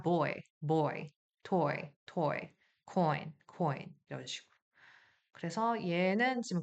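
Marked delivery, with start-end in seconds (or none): 0.0-4.2 put-on boy를 보이로 오에서 이로 미끄러지게; coin을 코인으로 이어 발음; oy diphthong. Shown as a demonstration. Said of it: "아이까지 붙으니까". (오이 이중모음 시범)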